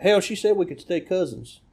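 high trashy Southern twang; trashy Southern accent. Acted. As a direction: deliver it as a trashy Southern punchline. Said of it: "pretty much universally trashy".

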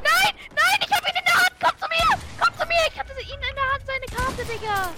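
upset yelling. Real, not acted. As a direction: wail that you lost. Just died in game, upset.

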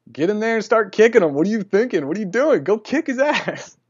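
loud, high, fast comic shout; hammed-up urging. He urges his friend to earn the money, comically yelling. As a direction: egg her on like an exasperated coach, hammed up.

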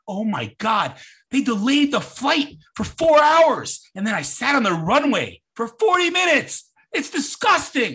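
mock outrage, high and drawn out; indignant complaint. Imitating people back from an international flight, mock indignant. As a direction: play an aggrieved passenger, loudly scandalized.

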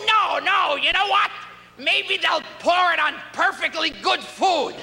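high, shouted, laughing scheme; excited shout. Retold or imitated. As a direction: pitch the gross idea at full yell. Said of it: "And they said".

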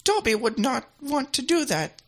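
high, slow house-elf voice; Dobby. Acted. Elf politely declining the praise, high slow impersonation. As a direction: speak as a timid little elf, slowly. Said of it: "That's a good impersonation there of Dobby".

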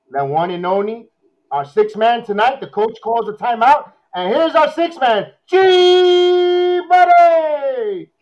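building arena-announcer hype, stretching the name; hype announcer intro. Acted. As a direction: announce him like a star entering the court. Hypes guest entrance on stream.

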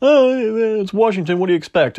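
high, scoffing mock voice; dismissive mock. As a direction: sneer it like a shrugging know-it-all. Mocking dismissive people.

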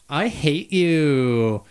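put-on slow, drawn-out girl voice; man doing a woman's voice, slowly. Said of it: "That was good".

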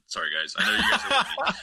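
sheepish laughing sorry to the listeners; laughing apology. Real, not acted. Laughs off his mini breakdown.

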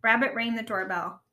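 ordinary delivery

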